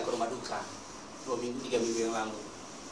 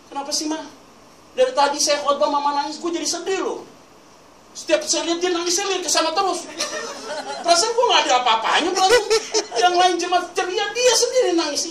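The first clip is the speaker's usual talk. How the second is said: high-pitched mock whining to Mom, building louder; exasperated complaint; 7 dB louder than usual. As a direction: plead in comic exasperation, climbing higher and louder. Voices his complaint to tearful mother. (mengeluh gemas dengan nada tinggi)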